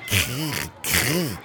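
soft rolled "Krrr, krrr" scratch; quiet scratchy mouse-paw sound; mouse scratching noise. Acted. Narrator imitating mice scratching, soft rolled scratch. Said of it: "Mäuse, die mit ihren kleinen Pfoten kratzen".

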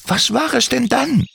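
leicht höher gesprochener Kobold, freundlich; junger Kobold. Acted. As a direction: ein kleiner, eifriger Kobold fragt nach. Timmy fragt. (Kobold Timmy fragt Andi, leicht höhere Stimme)

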